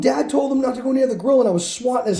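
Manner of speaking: mock sister talking her way out of trouble; defensive sister. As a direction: deny it fast to save herself. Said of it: "she's like".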